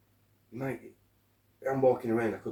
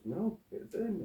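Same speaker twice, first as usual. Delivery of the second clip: put-on higher, slowed girlfriend voice; girlfriend's reply; 11 semitones higher than usual. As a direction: play her slow and high-pitched, a bit whiny. She goes.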